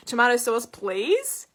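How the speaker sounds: "please" held apart and lifted like a question; hint at the missing manners with a rising "please"; prompting correction. Retold or imitated. Waitress reminding a customer of manners, prompting "please". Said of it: "she'd already been rude to me".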